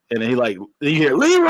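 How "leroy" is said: high, stretched-out name call; retold movie-style holler; mock yell. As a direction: imitate a yell, pitched way up. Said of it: "he just yelled".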